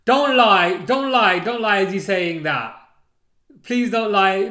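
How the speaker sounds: shouting in disbelief; loud disbelief. Real, not acted. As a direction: yell it, begging them not to lie. Shocked outburst at chat.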